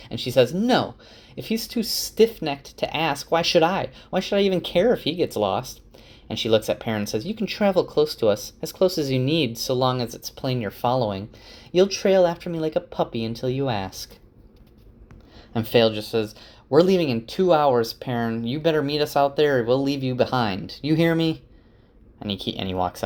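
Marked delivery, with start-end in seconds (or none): 0.5-5.8 put-on higher, sharp-tongued young woman; a voiced Faile, pitched up and dismissive; Faile's voice. Faile rebuffing Loial, voiced. 7.2-14.2 put-on higher, sharp-tongued young woman; a voiced Faile, pitched up and dismissive; Faile's voice. Faile setting terms for Perrin. 16.7-21.4 higher and commanding; voiced Faile giving a firm ultimatum; Faile's voice. Faile ordering Perrin.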